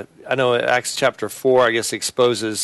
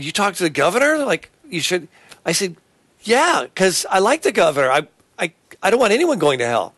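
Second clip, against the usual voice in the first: lively retold exchange; 7 semitones higher than usual. People quizzing him, his reply.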